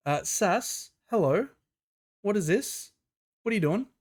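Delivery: high, bright-eyed hello, rising to a squeal; excited greeting. Real, not acted. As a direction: greet her like a friend who just barged in. Greeting a chat member excitedly.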